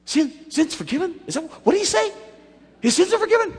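high-pitched baffled questioning; acted disbelief. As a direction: play stunned friends who can't believe their ears. Roof friends baffled by Jesus's words.

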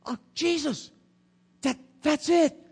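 high, pleading disciple voice; eager Philip. Retold or imitated. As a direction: beg like a disciple who finally gets it. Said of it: "And Philip then says".

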